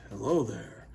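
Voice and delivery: a hint of a deep leading man; smooth, low heartthrob greeting; low suave voice. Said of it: "his voice was gonna be like".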